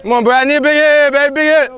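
high, loud dugout cheer. Real, not acted. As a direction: yell for a big hit.

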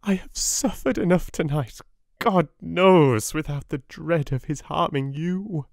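high, loud frantic plea; cling to him with your voice; crying out frantically. A narrator's reading. Wife begging her husband not to leave her, frantic. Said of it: "cried out".

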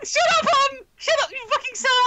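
high playful yell. Real, not acted. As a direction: shout it at a teasing friend. Yelling at teasing friend.

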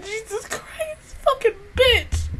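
tearful, strained high voice; crying. Real, not acted. Fan overwhelmed by the episode, crying. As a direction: choke it out through real tears.

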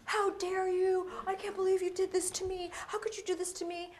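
mock outrage at the pigeon; furious man. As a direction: play the indignant victim of the pigeon. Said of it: "the man gets furious at the pigeon".

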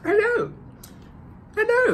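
high sing-song hello. Acted. Greeting young viewers with a high sing-song hello.

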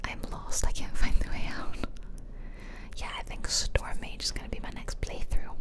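ordinary delivery

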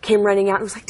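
ordinary talk, nothing marked

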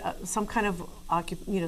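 usual speaking voice

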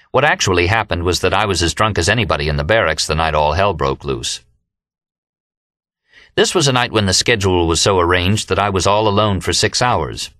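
ordinary delivery, nothing marked

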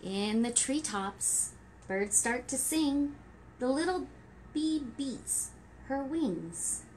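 gentle rhyming storybook read; reading to children. Acted. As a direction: read it like story time for toddlers. Reading rhyming picture book.

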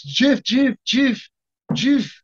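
imitating Jean-Claude hailing a friend; high, insistent calling of a buddy's name. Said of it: "And Jean-Claude goes".

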